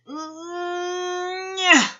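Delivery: high strained nyah; straining grunt. A narrator's reading. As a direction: squeeze out a high effort cry. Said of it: "and went".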